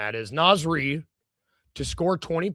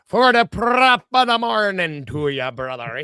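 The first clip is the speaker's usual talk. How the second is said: hammed-up Irish accent, slower and louder; 4 semitones higher, 6 dB louder, slower than usual. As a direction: play the show host with a big Irish lilt. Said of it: "show off my Irish accent".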